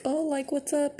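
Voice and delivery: high-pitched friendly hello; friendly greeting. Retold or imitated.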